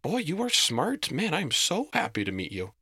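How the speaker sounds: high, swooping, impressed exclamation; delighted admiration. A narrator's reading. Goblin impressed that Reese knows the word, delighted. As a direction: marvel with high-pitched delight.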